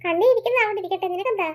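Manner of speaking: ordinary delivery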